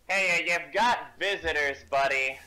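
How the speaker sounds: loud call across a room. Acted. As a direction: call out to get attention. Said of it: "call out saying".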